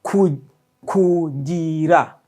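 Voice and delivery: Ku- split off, then kugira drawn out slowly; syllable by syllable. Shown as a demonstration. Teacher slows Kugira down for repeating students, drawn out. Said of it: "Repeat after me".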